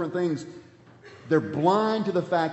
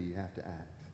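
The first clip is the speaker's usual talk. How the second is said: hushed emphasis; 8 semitones lower, 13 dB quieter than usual. Acted. Key point repeated, dropped low.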